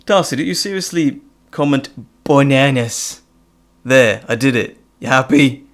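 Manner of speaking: fake-annoyed, then giving in with a grin; mock exasperation. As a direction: pretend outrage at a fan's joke. Mock-scolding a fan, then complying.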